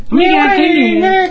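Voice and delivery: tiny squeaky gnome; high little gnome pipe. Acted. Gnome tugging at jeans.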